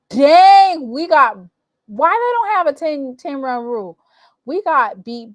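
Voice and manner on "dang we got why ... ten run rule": high, loud burst of outrage; loud disbelief. Real, not acted. Vlogger outraged by another blowout, loud disbelief. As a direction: blurt it out in disbelief. Outraged again at no mercy rule.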